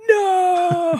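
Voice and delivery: high fake wailing cry; mock wail. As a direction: cry out in hammed-up despair. Mock grief over dropped beer.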